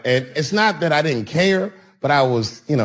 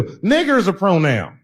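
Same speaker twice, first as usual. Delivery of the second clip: ordinary talk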